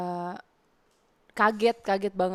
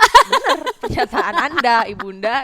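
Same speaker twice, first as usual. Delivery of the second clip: mock formal; 3 semitones higher, 11 dB louder than usual. Mock-formal reply to mother.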